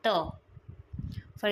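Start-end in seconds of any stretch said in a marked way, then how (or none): 0.1-0.1 त as a short, unaspirated "tuh"; changed final sound. Shown as a demonstration. Teacher showing that h turns to t at the end, sound demonstration. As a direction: say त alone, a brief dental t. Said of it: "final में होके ये change".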